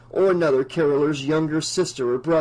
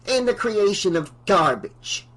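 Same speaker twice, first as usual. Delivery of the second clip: fierce; 6 semitones higher than usual. Acted. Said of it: "she added fiercely".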